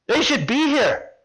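high, carrying woman's call; Miss Carol. Retold or imitated. Program leader looking for the hidden group, calling out. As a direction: lightly imitate a woman calling out. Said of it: "it's the voice of Miss Carol".

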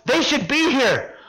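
high, carrying woman's call; Miss Carol. Retold or imitated. Program leader looking for the hidden group, calling out. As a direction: lightly imitate a woman calling out. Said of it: "the moment I hear that voice".

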